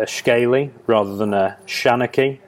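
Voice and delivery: ordinary talk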